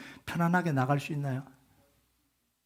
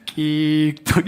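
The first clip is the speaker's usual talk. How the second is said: mock creak, a bit louder; creaking sound; 4 dB louder, much slower than usual. Pastor imitating the shed creaking in the wind, mock creak. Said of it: "이런 소리가 나요".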